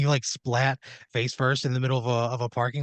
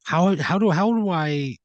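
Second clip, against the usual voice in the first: sudden higher, louder burst of disbelief; exasperated outburst; 6 semitones higher, 5 dB louder than usual. Acted. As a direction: blurt it out as an appalled goddess. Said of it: "And she goes".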